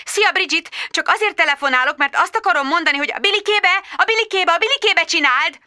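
high, quick, distracted mum voice; hurried high chatter to a toddler; harried friend. Magda phoning while potty training, quick and high.

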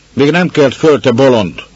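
a rushed servant snapping at a fool; gruff snap. Acted. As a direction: bite her head off, you're busy. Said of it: "mordult rá az".

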